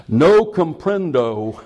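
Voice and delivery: hammed-up South Texas accent, drawn out; slow, mock Texan attempt at Spanish; put-on South Texas accent. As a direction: play dumb slowly in a Texas accent. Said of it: "South Texas accent".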